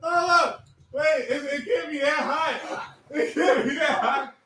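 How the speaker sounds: laughing, half crying, can't believe it; laughing disbelief. Real, not acted. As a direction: giggle through the words.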